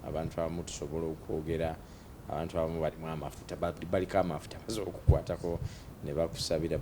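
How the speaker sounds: normal talking voice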